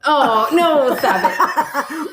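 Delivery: high, laughing "stop it"; laughing protest. Real, not acted. Cohost laughing at the hairy-monster joke. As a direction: giggle through a playful plea.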